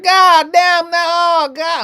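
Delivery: high, loud ecstatic cry over food; screaming to Jesus. Retold or imitated. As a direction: wail it out to the heavens. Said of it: "I'm just screaming to Jesus".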